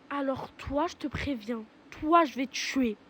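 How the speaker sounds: low, hushed menace; quiet threat. Acted. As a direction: threaten her under your breath.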